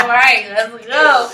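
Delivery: mock-leaving the party, laughing; laughing exit. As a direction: laugh through the exit line.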